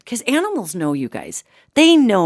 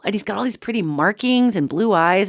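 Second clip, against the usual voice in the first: ordinary talk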